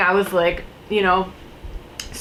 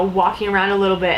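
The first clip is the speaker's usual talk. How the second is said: ordinary talk, nothing marked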